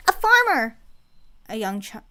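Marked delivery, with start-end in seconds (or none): 0.1-0.8 put-on high, loud child's cry; excited shout. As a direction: shout it out like a thrilled kid.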